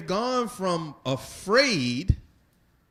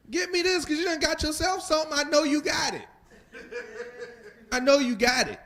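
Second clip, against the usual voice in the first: put-on much higher, fast, pushy kid voice; demanding child's inner voice; 12 semitones higher, faster than usual. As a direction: play the spoiled child who knows you have money. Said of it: "what they're saying is".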